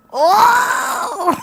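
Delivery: high loud whoop collapsing into laughter; shrieking laugh. Real, not acted. Reacting to her own naughty line, shrieking laugh. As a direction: shriek "oh" and crack up.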